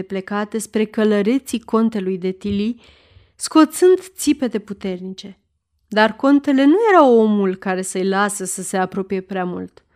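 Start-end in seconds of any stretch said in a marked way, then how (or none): none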